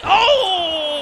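loud, high "oh" at a big hit; startled commentator outburst; excited shock. Real, not acted. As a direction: cry out at the impact. Reacting to a Samoan spike.

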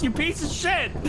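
high playful outraged yell; mock angry yell. Real, not acted. Accidentally hit teammate.

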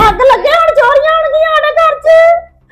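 high, slow wailing curse; wailing lament. Acted. Daughter-in-law wails at mother-in-law, high and slow.

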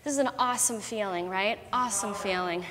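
quiet reassurance. Real, not acted. Quiet reassuring pep talk.

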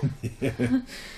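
a short, light, high laugh; high chuckle at Claudia's jab; amused laugh. Acted. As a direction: laugh off the teasing question. Laughs at a teasing question.

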